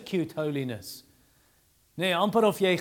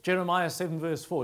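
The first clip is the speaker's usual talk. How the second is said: ordinary delivery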